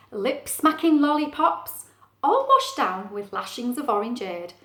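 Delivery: lively, relishing list of party food; animated storytime reading; story narration. Acted. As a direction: savour each treat for young listeners. Reads story aloud.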